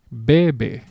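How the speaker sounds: bebe with the force on the first "be"; BE-be, second syllable falling away; stress on first syllable. Shown as a demonstration. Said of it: "the natural pronunciation of this word".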